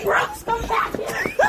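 high, slow long-distance shout; drawn-out, high-pitched holler; shouting after someone. Real, not acted. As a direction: yell for them to come back. Shouting after runaway Brooks.

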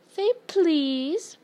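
high teasing lilt, rising on please; annoying sing-song. A narrator's reading. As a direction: taunt with a stretched please. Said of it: "his annoying sing-song voice".

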